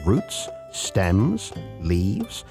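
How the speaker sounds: ordinary delivery, nothing marked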